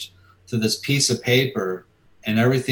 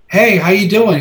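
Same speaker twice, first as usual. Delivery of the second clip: bright, louder, much higher hello; overeager greeting; 10 semitones higher, 8 dB louder than usual. Retold or imitated. Fan nervously greeting his comic idol, high and eager.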